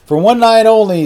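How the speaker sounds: high, loud stage announcer. A narrator's reading. Announcer opening the club show, big announcer voice. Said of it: "said the announcer".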